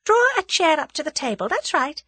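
high, light, politely in control; breezy courtesy with the upper hand; pleasant. A narrator's reading. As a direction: give orders as pleasant suggestions. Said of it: "she said pleasantly".

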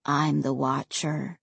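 low, heavy ghost voice; quiet regret, low and cold; deep and cold. A narrator's reading. Ray's voice.

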